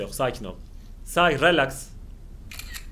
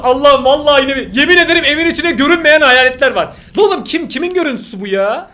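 panicked excitement; 7 semitones higher, 9 dB louder than usual. Real, not acted. Panicked reaction to ghost.

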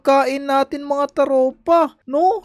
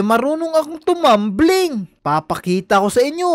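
ordinary talk, nothing marked

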